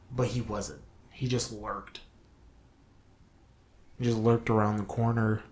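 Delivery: ordinary talk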